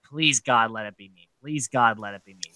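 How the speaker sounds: hushed imitated begging prayer; a mock plea under the breath; mock pleading. Imitating Nicole begging to be chosen, hushed.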